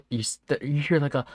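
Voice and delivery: ordinary delivery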